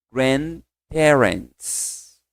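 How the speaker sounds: Grandparents, very slowly, D almost silent; slow model word. Shown as a demonstration. Teacher models grandparents slowly for students. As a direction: drag out Grandparents.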